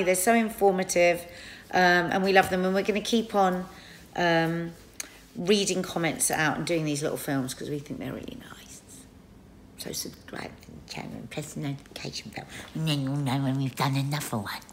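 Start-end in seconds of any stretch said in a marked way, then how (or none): 5.4-14.6 soft whispered outro; whispering. Real, not acted. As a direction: whisper the call to subscribe. Whispered sign-off to viewers.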